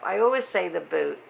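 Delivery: ordinary talk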